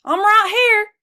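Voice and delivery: high horse voice answering; snorting horse. Acted. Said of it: "snorted Mister Brown".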